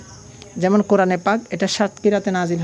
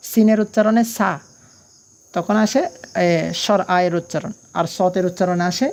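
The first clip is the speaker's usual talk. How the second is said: normal talking voice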